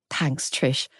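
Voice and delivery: quiet thanks to the host; soft. A narrator's reading. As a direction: murmur "Thanks, Trish" gently. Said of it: "Adele said".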